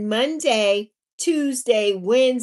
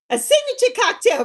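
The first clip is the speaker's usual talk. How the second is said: pitched way up and louder; excited squeal; 10 semitones higher, 4 dB louder, faster than usual. Real, not acted. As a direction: squeal it with delight. Excited repeat, high.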